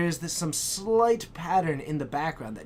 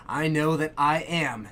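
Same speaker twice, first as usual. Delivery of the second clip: ordinary talk, nothing marked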